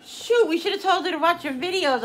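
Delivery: husband realizing too late; excited husband. Retold or imitated. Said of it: "my husband says to me".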